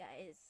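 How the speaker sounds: "guys" with a soft j at the start; slipped pronunciation. Real, not acted. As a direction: say "guys" like "jies", very quietly. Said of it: "I said jies".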